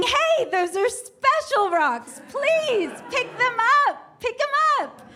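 high-pitched pleading yell, acted out; retold upset cry for help. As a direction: plead desperately, voice climbing high. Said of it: "So I'm very upset. I'm yelling".

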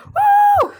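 high, loud "woo" of glee; excited whoop. Retold or imitated. Dog racing out to meet the mailman, excited whoop. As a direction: whoop like an overjoyed cartoon dog. Acts out Dog dashing to mail.

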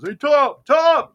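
high, loud call to Tom; acted yell. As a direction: yell out for someone far away. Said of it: "Yells at Tom".

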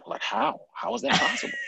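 high, stunned how; mock amazed friend; amazed disbelief. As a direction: act baffled at how she plays. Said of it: "he's like".

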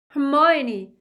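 loud, high, anguished yell; bellowing. A narrator's reading. Boy calls to tortured friend above, desperate bellow. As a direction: bellow the name upward through the ceiling. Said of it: "Ron bellowed".

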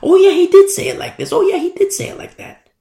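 very high, excited voice of recall; dawning realization. Retold or imitated. Disciples remember after the fact, dawning realization. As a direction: act out it suddenly clicking. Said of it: "started to put two and two together".

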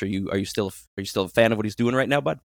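usual speaking voice

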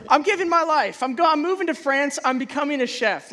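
high, loud, swept-away declaration; impassioned mock resolve. Chef's Table viewer vowing to become a chef, excited. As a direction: announce a wild life change with mock fervor.

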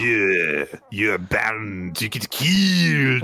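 slow, drawn-out snooty warning; mustache guy voice. Pretend. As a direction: stretch it out like a smug instructor. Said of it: "he goes something like".

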